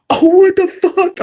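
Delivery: high, loud, slow disbelieving gasp; shocked gasp. Retold or imitated. Reliving watching the presser alone, shocked. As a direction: act out the jaw-dropping moment. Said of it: "I was reacting to it".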